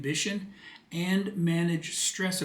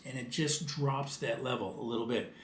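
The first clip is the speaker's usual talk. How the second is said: lowered voice; 3 semitones lower, 7 dB quieter than usual. Shown as a demonstration. Showing parents how lowering the voice sounds, softer. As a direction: drop the voice, noticeably softer. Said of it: "Simply lowering your voice".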